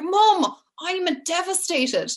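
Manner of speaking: high-pitched exasperated protest, slowing down; cross with mum. Retold or imitated. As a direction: re-enact an upset daughter protesting to her mother. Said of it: "I was so cross with her".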